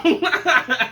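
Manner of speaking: breaks into a high laugh; high burst of laughter. Real, not acted. Vlogger laughing at his own courtroom gag, high laugh.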